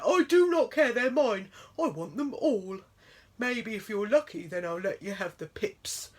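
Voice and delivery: loud, gloating bear voice; greedy bear. A narrator's reading.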